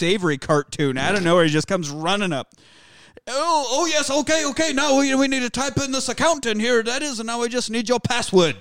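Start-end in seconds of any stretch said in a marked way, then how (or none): 3.3-8.6 high-pitched, hammed-up Monty Python Brit; Monty Python British accent. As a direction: play it as a fussy high Python Englishman. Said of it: "like, a Monty Python British accent".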